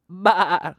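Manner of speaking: a short loud baa; sheep bleat. Shown as a demonstration. Reading to a toddler, answering with a sheep baa. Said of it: "Can you make a sheep sound?".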